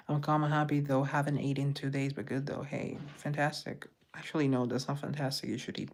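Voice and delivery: ordinary talk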